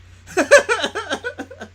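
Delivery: a loud, high laugh bursting out; high burst of laughter. Real, not acted. Laughing at own quote.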